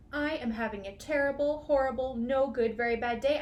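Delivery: loud fed-up declaration, pushed out word by word; loud, whiny protest of a sulking boy; complaining to everybody. Acted. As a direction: announce your misery to the whole room. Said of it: "I told everybody".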